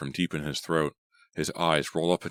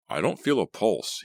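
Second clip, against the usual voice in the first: ordinary delivery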